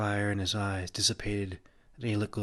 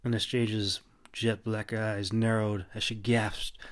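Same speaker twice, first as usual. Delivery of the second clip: ordinary delivery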